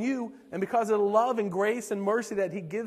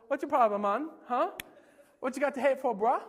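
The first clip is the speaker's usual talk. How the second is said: higher-pitched mock Jamaican retort; Jamaican accent; 4 semitones higher, faster than usual. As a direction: challenge them in a put-on Jamaican accent. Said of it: "talk like I was Jamaican".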